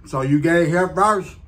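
talking between bites; chewing. Real, not acted. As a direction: mouth partly full of food. Seen 1: Bites at -3, talks holding burger, bites again from 4.8.

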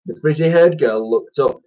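plain reading voice

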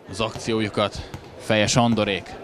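ordinary talk

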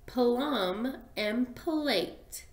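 plum and plate said very slowly; slow demo. Shown as a demonstration. Teacher repeating the pair slowly for the class. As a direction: draw each word out. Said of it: "those words don't sound the same".

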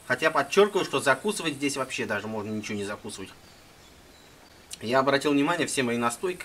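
speaking with food in mouth; chewing while talking. Real, not acted. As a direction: mouth partly full. Seen 0: ate currants just before; holds plate, jaw busy while talking.

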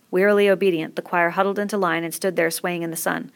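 usual speaking voice

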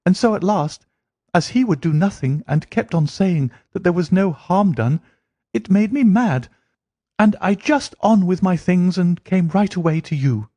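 high, heated complaint; Mary complaining about her stepfather, high and heated; indignant young woman. Acted.

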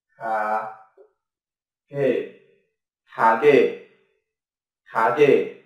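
"khá kê" said syllable by syllable, soft k onsets; Korean word demo. Shown as a demonstration. Teacher modelling the Korean word for shop, slow and careful. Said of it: "Ví dụ từ".

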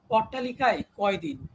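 usual speaking voice

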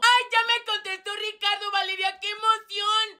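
high-pitched gushing; excited squealing. Acted. Regina tells Valeria that Ricardo answered, squealing. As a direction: squeal the news to your friend. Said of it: "Tus gritos se escuchan".

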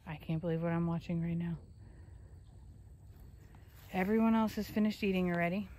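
ordinary delivery

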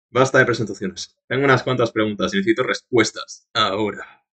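low, quiet, composed voice; lightly voiced stern stranger; cool mysterious swordsman. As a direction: talk down to the boy calmly. Voices Arikado's lines.

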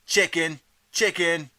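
high, loud taunting chant of chicken; jeering cries. A narrator's reading. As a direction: jeer it up high like a schoolyard taunt. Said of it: "You can hear the cries of".

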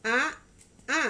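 A and ba each said loud and slow, apart; over enunciating. Shown as a demonstration. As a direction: sound out each syllable, A then ba. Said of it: "ikutin Mama ya".

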